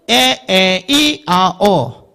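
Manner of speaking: 에, 에, 이, 아, 어 as separate short pure vowels; teacher sounding out short 에, 에, 이, 아, 어. Shown as a demonstration. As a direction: demonstrate 에, 에, 이, 아, 어 with no glide. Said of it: "짧을 때는".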